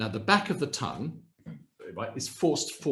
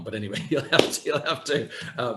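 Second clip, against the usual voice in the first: normal talking voice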